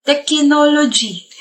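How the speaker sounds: Technology stretched out slowly, syllable by syllable; slow drawn-out word. Shown as a demonstration. Guy slowly repeats the word to the girl, drawn out. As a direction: draw out Technology teasingly. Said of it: "Information technology".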